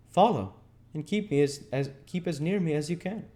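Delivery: high, loud urgent order; Harry's command. A narrator's reading. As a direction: call them after you as you run. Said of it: "So saying".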